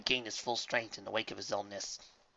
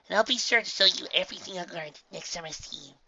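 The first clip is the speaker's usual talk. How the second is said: put-on young filly voice; 7 semitones higher than usual. A narrator's reading. Filly promise.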